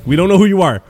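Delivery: hammed-up shout of the retold group; retold alarm. Retelling the anime scene, a mock alarmed shout.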